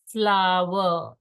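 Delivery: "Flower" stretched out slowly; very slow model. Shown as a demonstration. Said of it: "saying it in a very slow manner".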